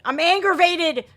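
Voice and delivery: fed-up yell at the screen; aggravated outburst. Real, not acted. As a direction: let the annoyance burst out. Repeats complaint, louder.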